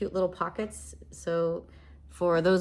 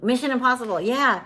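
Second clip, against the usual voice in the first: blurted out louder and higher as it finally comes to her; "got it!" excitement on the title; sudden recall; 6 semitones higher, 8 dB louder than usual. Real, not acted. Streamer finally recalling the film for her dad, excited.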